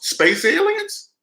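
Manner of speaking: mock the idea with a drawn-out high squeak; sarcastic question. Scoffing at who lives in pricey apartments, high sarcastic squeak.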